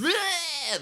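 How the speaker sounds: loud, high-pitched "bleh" cry; loud high yelp. Acted. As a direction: blurt out a wild high bleh. Hyped teen boys bit.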